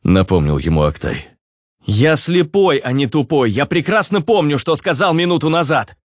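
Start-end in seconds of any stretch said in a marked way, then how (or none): 1.8-6.0 raised, irritated snap; angry retort. A narrator's reading. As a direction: bite back, offended. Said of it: "разозлился Руслан". (раздражённо, на повышенных тонах)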